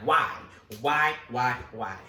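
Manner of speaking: loud, exasperated repeated why. Retold or imitated. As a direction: demand an answer, fed up. Exasperated questioning of the fairy.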